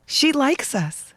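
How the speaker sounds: high, slow gushing; delighted. Real, not acted. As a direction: swoon over the celebrity shout-out. Delighted reaction to the greeting.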